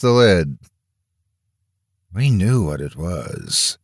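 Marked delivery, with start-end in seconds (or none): 2.1-3.8 slow, lightly hoarse; a hint of a hoarse young father; husband admitting the truth, slow and mildly hoarse. A narrator's reading. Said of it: "he said hoarsely".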